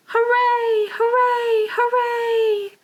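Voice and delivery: high cheers fading away; echoing hooray. Acted. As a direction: call out as if echoing over ice. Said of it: "it echoed across the ice".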